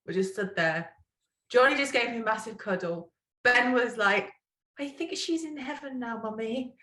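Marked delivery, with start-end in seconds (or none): 4.8-6.8 put-on soft, high-pitched small child's voice; little son consoling. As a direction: imitate a child gently telling Mummy. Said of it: "like that".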